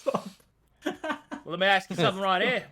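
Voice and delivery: fast, loud Aussie demon hunter; high, matey Australian; Australian accent. Acted. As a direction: pitch it up and talk like an Aussie mate. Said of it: "Aussie demon hunters".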